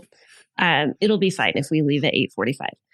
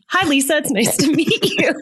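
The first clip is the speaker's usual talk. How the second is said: laughing while quoting; 9 semitones higher than usual. Retold or imitated. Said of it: "And he says".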